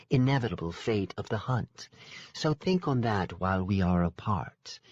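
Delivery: lightly voiced aloof royal woman; regal fae sister. Sister closes the deal with the hero, aloof. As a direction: dismiss them with cool grace.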